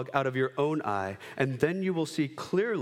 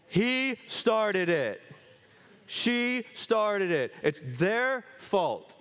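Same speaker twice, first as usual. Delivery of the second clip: put-on higher, slow, drawn-out childish blame; whiny kid excuse; 7 semitones higher, much slower than usual. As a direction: whine it like a caught kid pointing fingers. Kids' excuse when caught fighting.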